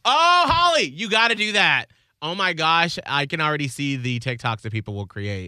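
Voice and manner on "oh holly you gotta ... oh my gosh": high, loud burst of excitement; excited. Real, not acted. Cohost hears Holly's video idea, excited. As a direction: gush loudly over a brilliant idea.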